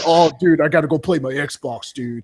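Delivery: hyped stoner gamer bro, each "dude" dropping off. Acted. Guest mimicking Xbox fans at school, stoner dude voice. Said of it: "Yeah, the stoner motherfuckers".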